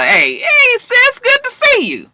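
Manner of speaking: very high, drawn-out fake cheer; fake-friendly greeting. Uncomfortable friends greeting Rashida, fake-friendly. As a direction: greet her in a squeaky put-on sweet voice.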